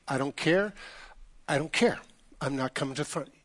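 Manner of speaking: soft, dismissive mock grumble; retold stubborn refusal. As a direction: wave them off under your breath.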